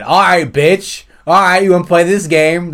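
loud, high, stretched-out taunt; mock challenge. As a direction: throw down the gauntlet, hammed-up. Acting out Disney's challenge to rival.

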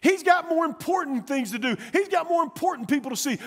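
high-pitched, insistent scolding; exasperated crowd voice, pitch up; acted rebuke. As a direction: scold him into silence. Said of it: "And many rebuked him".

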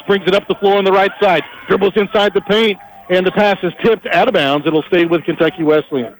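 usual speaking voice

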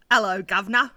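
loud, badly faked British accent; Hugo's accent. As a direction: have a half-hearted go at a British accent. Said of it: "Do your boyfriend Hugo's accent".

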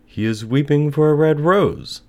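high, loud bird voice; nightingale. A narrator's reading. Nightingale explains the student's weeping, high and loud. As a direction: raise it high and clear as a nightingale. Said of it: "said the nightingale".

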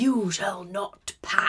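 slow, grand wizard command; hammed-up wizard barring the way; wizard impression. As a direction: draw each word out portentously. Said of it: "I thought you were like".

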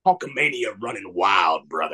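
very low, growled wrestler voice; low macho showman impression; wrestler catchphrase. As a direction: drop deep for a wrestling catchphrase. Quotes Hulk Hogan catchphrase after reveal.

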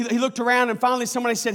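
ordinary talk, nothing marked

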